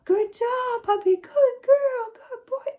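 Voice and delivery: very high, loud, drawn-out gushing praise; sky-high sing-song good girl. Shown as a demonstration. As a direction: squeal it like praising a puppy. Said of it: "praising the puppy demo".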